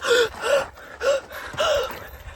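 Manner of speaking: exaggerated high gasp. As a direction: gasp in mock surprise. Mime gasping back and forth.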